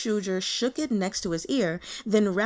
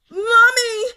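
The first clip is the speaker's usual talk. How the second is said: shrieking, much higher and louder; blood-curdling scream; 14 semitones higher, 11 dB louder than usual. Acted. Terrified intern screaming for his mother, shrieking. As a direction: scream it in pure terror. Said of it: "A blood-curdling scream exploded out of him".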